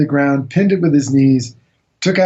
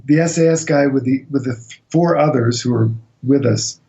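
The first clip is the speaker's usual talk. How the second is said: ordinary delivery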